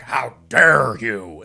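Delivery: hammed-up, drawn-out affront, low and loud; slow, booming parody of someone scandalized; mock outrage. Woman offered a hand by a polite guy, mock-outraged.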